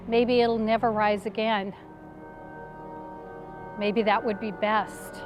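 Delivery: low, soft and hopeless; subdued, despairing murmur to herself; quiet despair. Acted. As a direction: give up hope, voice sinking low. Mary despairs in the dark.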